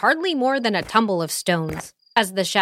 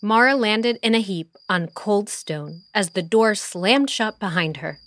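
ordinary delivery, nothing marked

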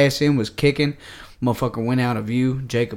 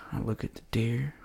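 ordinary talk, nothing marked

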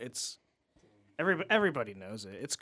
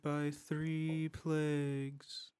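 eerie pharaoh; slower than usual. Retold or imitated. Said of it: "King Ramses".

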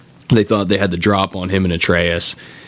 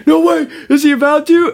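high, loud amazed yelling; excited shout; 6 dB louder than usual. Retold or imitated. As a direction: yell in hyped disbelief. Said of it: "you're just like".

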